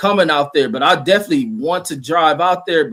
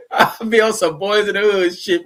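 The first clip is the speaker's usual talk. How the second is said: higher, talking through a laugh; 5 semitones higher than usual. Real, not acted. Joker amused by his own ride share bit, laughing. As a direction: laugh your way through the line.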